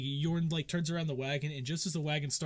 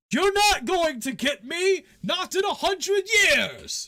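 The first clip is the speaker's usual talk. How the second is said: much higher, loud, slow cartoon bandit yell; bandit taunt; 10 dB louder, slower than usual. Bandit taunting travellers from the tower, high shouted gloat.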